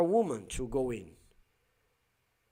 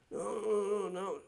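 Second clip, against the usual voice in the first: high, quick string of protests; flustered denial; 7 semitones higher than usual. Retold or imitated. Drugstore man answering the police officer, high flustered denial. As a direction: imitate someone hastily backing down.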